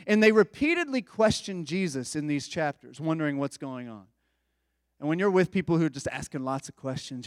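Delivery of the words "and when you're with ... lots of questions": ordinary delivery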